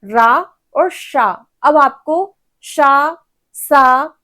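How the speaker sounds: रा, शा, सा drawn out very slowly and set apart; slow sound demo. Shown as a demonstration. Teacher contrasts शा and सा for students, slowly. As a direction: stretch रा, शा and सा to contrast them. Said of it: "हमारा टंग twist होके".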